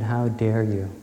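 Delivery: ordinary delivery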